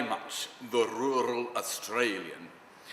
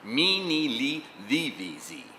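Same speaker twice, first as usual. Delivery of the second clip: higher, measured vocal-exercise syllables; elocution drill; 4 semitones higher than usual. Retold or imitated. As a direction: intone it like a diction exercise.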